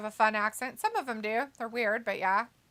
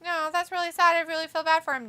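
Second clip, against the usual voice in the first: higher-pitched fake pity; mock sympathy; 7 semitones higher, faster than usual. Reacting to Pac-twelve crumbling, mock sympathy. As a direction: lay on the insincere sympathy.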